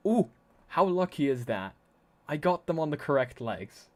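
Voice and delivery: sudden gasp of delight; loud pleased gasp then a grin; surprised. Real, not acted. Trackers on correct legs.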